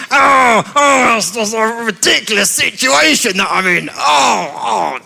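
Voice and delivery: hammed-up exasperated outburst; acted frustration, drawn-out exclamations; angry, frustrated. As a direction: groan and rant at a ridiculous predicament. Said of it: "he gets angry and frustrated".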